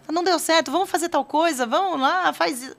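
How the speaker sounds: high, loud, urging mock-parent voice; pushy fixing. Parent jumping in to fix the upset child's problem, insistently.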